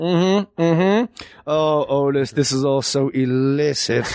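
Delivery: lightly voiced seductive hum; a hint of a mock lover whispering to Otis; mock sultry murmur. Attell talks to the box set.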